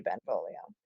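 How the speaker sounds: low, quiet mock menace; menacing threat. Villain Tybalt threatening to kill Benvolio, low quiet menace.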